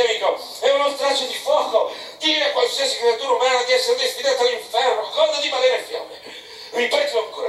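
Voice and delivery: a captain boiling over at an insult; outraged Peleg. A narrator's reading.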